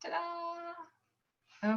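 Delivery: high, soft sing-song reveal; playful ta-da. Real, not acted. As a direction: unveil the slide like a little magic trick. Slideshow finally shared on screen.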